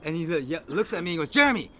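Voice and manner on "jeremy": high, loud mock-angry shout; imitated angry call. Imitating friend calling to Jeremy while leaving, mock angry. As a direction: call it out as the friend storming away. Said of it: "storms out from the parking lot".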